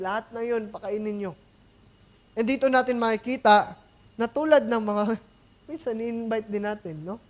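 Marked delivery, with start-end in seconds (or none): none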